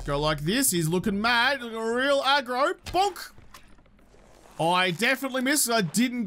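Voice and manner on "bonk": high squeaky bonk sound effect; playful high bonk; comic bonk. Pretend. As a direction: voice the hit as a cartoon bonk. Voicing a comic hit sound.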